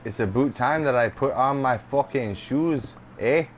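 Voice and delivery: hammed-up Canadian "aboot" and "eh"; put-on Canadian voice, rounded vowels; mock Canadian accent. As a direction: tease with an exaggerated Canadian accent. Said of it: "if we were to hear you talk".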